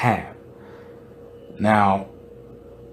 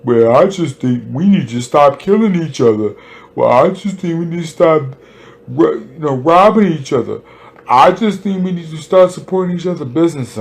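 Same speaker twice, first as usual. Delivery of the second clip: high, loud mocking voice of a naive community member, much higher and louder; mocking community talk; 6 semitones higher, 6 dB louder than usual. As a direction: parody the well-meaning advice, pitched up and louder. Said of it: "That's our first and chronic problem is".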